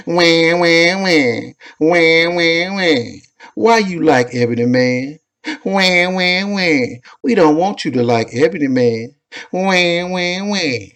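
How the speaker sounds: high whiny crybaby wailing; blubbering. Retold or imitated. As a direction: wail it like a sulking baby. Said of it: "make them haters start crying like a baby".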